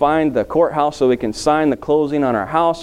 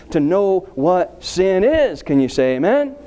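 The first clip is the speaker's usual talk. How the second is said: normal talking voice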